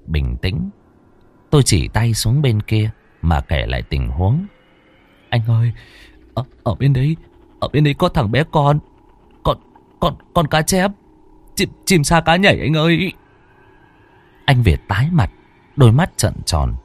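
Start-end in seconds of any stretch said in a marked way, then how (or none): 5.3-13.1 shaky stammering fright. A narrator's reading. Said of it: "Tôi run như cầy sấy".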